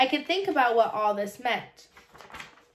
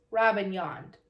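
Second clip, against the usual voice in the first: usual speaking voice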